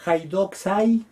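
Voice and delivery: "haidoxai" said slowly; slow careful "haidoxai"; slow Greek demo. Shown as a demonstration. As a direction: read "haidoxai" slowly for the class. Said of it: "las opiniones".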